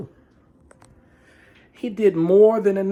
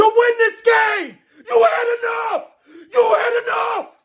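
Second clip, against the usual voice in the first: furious shout; 13 semitones higher than usual. Real, not acted. Fan yelling at the coach, high furious shout. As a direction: hammer it home, enraged.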